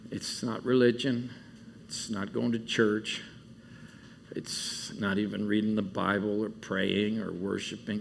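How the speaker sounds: soft, low, heartfelt prayer; emotional prayer. Real, not acted. Camp speaker praying to Jesus after worship, softly emotional. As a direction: pray quietly, moved, with long pauses. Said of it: "I'm a little bit emotional".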